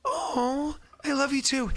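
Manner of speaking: high, cooing imitation; imitated wife. Husband relaying wife's love to friend, high cooing imitation. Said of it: "She's like".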